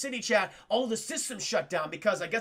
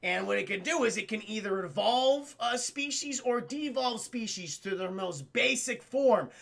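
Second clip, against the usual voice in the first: normal talking voice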